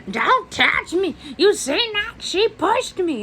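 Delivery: put-on high, slow whiny old lady; mock old lady. Imitating the old lady crying she was pushed. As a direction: whine it like a dramatic Karen. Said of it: "And she's like".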